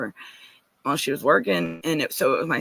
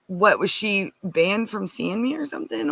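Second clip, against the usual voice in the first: usual speaking voice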